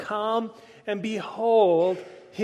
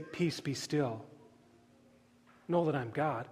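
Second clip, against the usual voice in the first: hushed, lowered voice of comfort; gentle reassurance; 6 semitones lower, 10 dB quieter than usual. Retold or imitated. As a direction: say it quietly, as if soothing frightened followers. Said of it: "he doesn't turn to the disciples and say".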